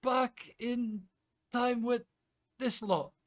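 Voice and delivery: high, loud, incredulous Scotsman; Scottish man in disbelief; broad Scottish brogue, amazed; Scottish accent. Acted. GM plays Callum.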